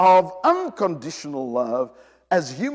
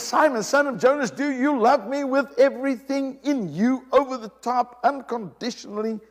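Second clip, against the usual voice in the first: higher, urgent appeal; pleading Jesus; 6 semitones higher than usual. Acted. Jesus pressing Peter about his love, higher and pleading. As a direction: plead with him as a friend.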